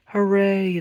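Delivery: high joyful cheer; excited cheer. A narrator's reading. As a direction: shout hooray with delight. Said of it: "the machines cried".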